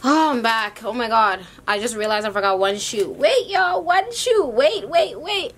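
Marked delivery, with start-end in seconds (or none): 3.1-5.5 much higher, louder excited calls; excited high wait. Real, not acted. To the viewers after a forgotten shoe, excited high calls. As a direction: catch the viewers back in a burst.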